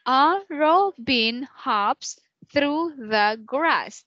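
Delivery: a robin hops through the grass paced out slowly; slow syllable count. Shown as a demonstration. Teacher modelling the line slowly for Ken. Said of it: "So we have the first syllable".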